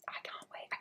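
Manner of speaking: excited breathy whisper to chat. Acted. Streamer whispering to chat about berry season. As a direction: hush it like a secret thrill.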